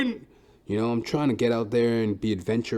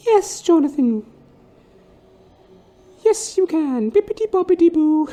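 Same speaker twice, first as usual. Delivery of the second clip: sing-song like a storybook fairy godmother; fairy godmother answering his wish, pitched high and grand; 8 dB louder than usual. Pretend. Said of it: "I'll ask my fairy godmother".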